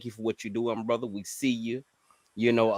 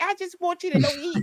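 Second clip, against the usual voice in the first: squeaky-high, small imitation of a woman; small sweet voice; 3 dB louder than usual. Caller mimicking the young lady on the platform, small high voice. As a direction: mimic her in a tiny, very high falsetto. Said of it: "She got a small voice".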